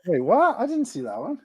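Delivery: high-pitched disbelief; surprised. Real, not acted. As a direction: blurt it out high, caught off guard. Caught off guard by another build.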